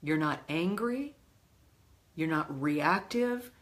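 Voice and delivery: normal talking voice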